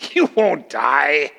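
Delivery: slow mocking assurance; sarcastic. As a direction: stretch the words out, dripping with sarcasm. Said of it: "That's how it comes across".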